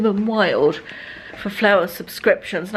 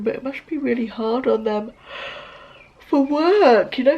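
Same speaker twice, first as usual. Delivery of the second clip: yawning; 2 semitones higher than usual. Real, not acted. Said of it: "excuse me yawning".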